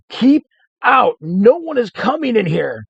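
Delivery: high, loud frantic yell; scream. Acted. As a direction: shriek to keep them out.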